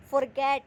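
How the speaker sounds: Forget with a loud, stressed "गेट"; stress on final syllable. Shown as a demonstration. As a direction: punch the ending of forget, through to the ट. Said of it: "ट पर ज़्यादा stress आ रहा है".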